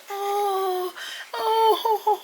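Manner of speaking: high, loud mock wails of pain; imitating crying groans. Neighbor groaning in pain after the accident, loud high wails. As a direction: wail out like a hurt old man. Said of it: "He is crying".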